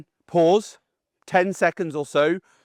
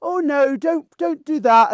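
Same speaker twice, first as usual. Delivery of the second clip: mock squeamish plea; 10 semitones higher, 3 dB louder, slower than usual. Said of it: "I won't go".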